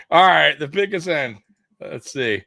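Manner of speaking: ordinary talk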